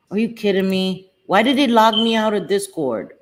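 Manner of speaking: ordinary delivery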